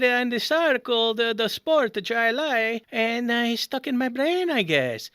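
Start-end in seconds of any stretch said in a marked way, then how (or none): none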